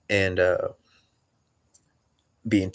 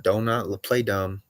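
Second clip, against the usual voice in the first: ordinary talk, nothing marked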